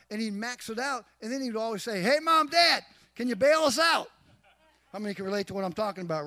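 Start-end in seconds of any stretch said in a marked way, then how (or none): 2.0-4.1 loud, high-pitched imitation of a son begging; mock plea, pitched up and loud; pleading son. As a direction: call out like a kid wanting a bailout. Son pleading to parents for money.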